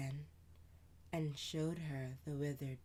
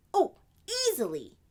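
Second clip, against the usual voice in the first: higher, louder, quick big-sister voice; older sister voice; 13 semitones higher, 13 dB louder than usual. Acted. Sister answering Amani, lively and louder. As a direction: play the lively older sister telling a tale.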